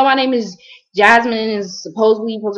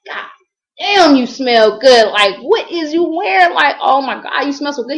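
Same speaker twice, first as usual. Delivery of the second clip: high, worked-up gushing; imitating him tripping; 5 semitones higher, 3 dB louder than usual. Retelling a stranger raving about her perfume, high mock-amazed imitation. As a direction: exaggerate a man floored by a scent. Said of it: "cause he was tripping".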